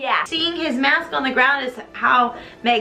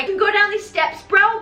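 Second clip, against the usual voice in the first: mock urging; 7 semitones higher than usual. Madame Giry leading Raoul to the steps, high comic urging. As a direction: pitch it up and push him along.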